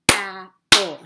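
"Apple" split into two loud beats; syllable by syllable. Shown as a demonstration. Teacher counting claps with children, syllable by syllable. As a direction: punch each syllable of "Apple" separately.

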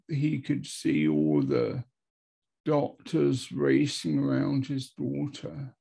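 normal talking voice

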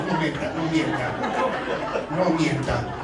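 laughing tease. Real, not acted. Teasing congregation about lying.

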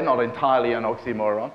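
normal talking voice

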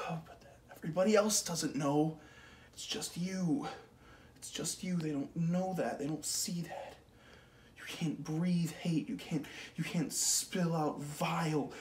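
bitter rant. Acted. Man vents about someone who hurts him, rising bitter rant. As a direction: spit out the grievance.

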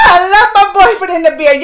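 loud, squealing delight; excited gushing. Real, not acted. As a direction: gush over the crush, nearly crying. Gushing over crush's beard photo.

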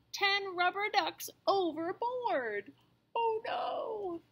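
high, crying alarm; captain in a panic; panicked shout. Acted. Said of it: "shouts the captain".

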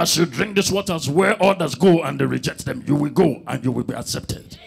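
low solemn preacher voice imitating the pastor; pastor's prayer. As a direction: intone it like a pastor blessing water.

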